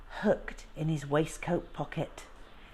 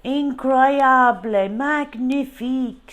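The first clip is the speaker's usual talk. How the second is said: put-on slow, high, loud wonder in a French accent; amazed Frenchman; 7 semitones higher, 9 dB louder, slower than usual. Frenchman amazed at the lion, slow and loud. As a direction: marvel at the lion, drawing out each word.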